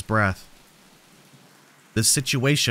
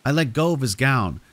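usual speaking voice

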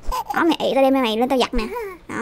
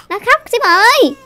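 raised, drawn-out call to a friend; 7 semitones higher, 5 dB louder, slower than usual. Real, not acted. Player calls co-player about the crying baby, calling out. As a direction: call out high, loud and slow.

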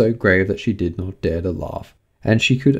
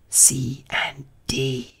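very slow, hushed whisper; 5 semitones higher, 6 dB quieter, much slower than usual. A narrator's reading. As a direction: whisper each letter separately, half afraid. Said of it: "she added in a whisper".

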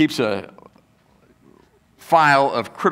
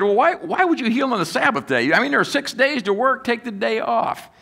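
mock critic; 8 semitones higher than usual. Voicing the Sabbath critics.

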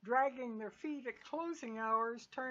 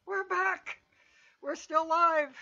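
excited; 8 semitones higher, 9 dB louder than usual. Real, not acted. Host opens the live show.

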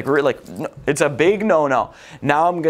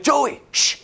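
high, sharp shush; mom shushing; 9 semitones higher than usual. Retold or imitated. Mom hushing her son in the library, high sharp shush. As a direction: hush the kid like a stern mom. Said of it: "And she'd be like".

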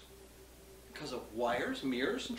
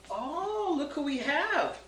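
mock surprised; 10 semitones higher, much slower than usual. Mock surprise at new guest.

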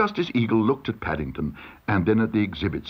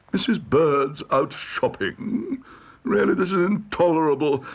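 higher, affronted pompous judge; exasperated judge; 6 semitones higher than usual. A narrator's reading. Judge learns Missus Bird is out shopping, exasperated. Judge outraged at missing witness.

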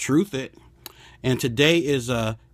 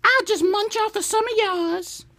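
much higher, dainty put-on voice; wife's voice. Husband imitating his wife at the restaurant, high and dainty. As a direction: sweetly claim you want little. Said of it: "she be like".

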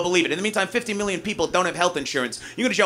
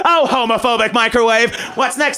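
ordinary talk

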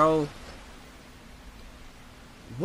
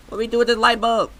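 exasperated yell; 9 semitones higher, 9 dB louder, faster than usual. Real, not acted. Frustrated at the game.

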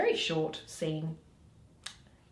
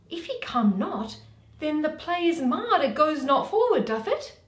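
lightly voiced fretful Flute, higher and louder; 7 semitones higher, 7 dB louder than usual. Flute worrying to Quince about the play, mildly higher. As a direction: fret over the play a bit higher.

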